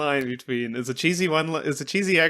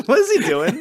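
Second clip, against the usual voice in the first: laughing through the question; amused, laughing disbelief; 9 semitones higher, 7 dB louder than usual. Real, not acted. Laughing at a silly scene.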